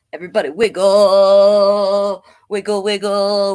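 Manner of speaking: loud, slow, calling everyone to wiggle; rally the kids to dance; cheerful dance call. Acted. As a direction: worm leading a dance, loud. Worm leads dance.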